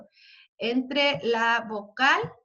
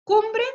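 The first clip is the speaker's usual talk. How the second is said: cumbre called out higher and louder; stressed rhyme word; 8 semitones higher, 7 dB louder than usual. Shown as a demonstration. Teacher prompting students for the rhyme, loud and high. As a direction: punch cumbre for the class. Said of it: "Letra D".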